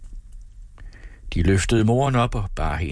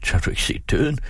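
higher pitch, rising in dismay; startled question; 7 semitones higher than usual. A narrator's reading. Father strokes daughter's hair, startled question. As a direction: ask it in mild alarm. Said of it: "strøg hende forskrækket".

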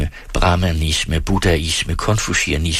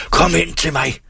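high-pitched, forceful yell; angry father's summoning shout; full-lunged shout; 9 semitones higher than usual. A narrator's reading. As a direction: bellow an order across the yard. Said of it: "råbte han af sine lungers fulde kraft".

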